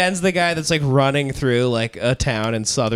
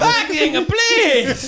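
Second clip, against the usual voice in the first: high, slow, laughing plea; begging Italian voice; much slower than usual. Pretend.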